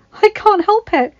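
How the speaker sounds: put-on high, loud, slow gushing girl; excited exclaim. A narrator's reading. Mirabelle gushing to her father about her room, excited. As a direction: bubble over with delight. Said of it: "she exclaimed".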